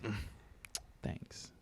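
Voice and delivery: a flat, barely-there "thanks"; fading, unimpressed thank-you; dismissive mumble. Retold or imitated. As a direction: brush him off with a mumbled thanks. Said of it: "they're just like".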